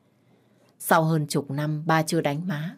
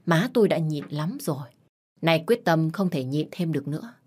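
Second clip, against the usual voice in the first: plain reading voice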